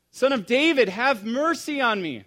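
high, loud, full-voiced cry; shouted plea. Blind man trying again despite the crowd, shouting. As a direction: belt out the plea for mercy. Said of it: "I'm gonna do it again".

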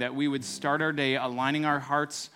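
fervent spoken prayer, building in force; pastor's closing prayer voice; earnest prayer. Acted. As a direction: pray aloud over the congregation.

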